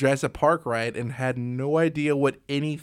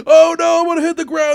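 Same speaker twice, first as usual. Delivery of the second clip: mock panic; 12 semitones higher, 9 dB louder, faster than usual. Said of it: "you're like".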